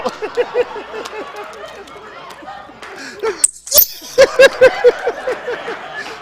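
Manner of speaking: loud high cackle; laughing hard after the chant; high burst of laughter. Real, not acted. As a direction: crack up loudly.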